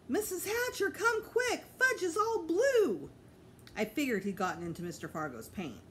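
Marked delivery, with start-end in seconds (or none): 0.1-3.1 high, loud frantic scream; screaming for help. A narrator's reading. Sheila screaming for Mom that Fudge is blue, high and loud. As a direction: yell it across the yard in panic. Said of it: "So when Sheila screamed".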